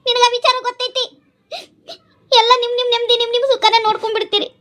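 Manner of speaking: high-pitched, upset reproach; hurt accusation. Acted. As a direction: lash out at your husband, hurt. Upset wife accuses husband in quarrel.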